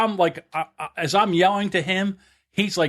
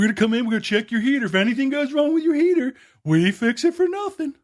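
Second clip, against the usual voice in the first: salesman's pitch; 8 semitones higher than usual. Retold or imitated.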